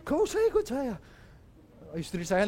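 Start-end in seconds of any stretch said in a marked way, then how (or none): 0.0-1.0 lightly voiced master, higher, brushing him off; a hint of a master waving the slave away; dismissive master. Master telling the slave not to come, lightly mocked. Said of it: "Budak diusir lagi kayak".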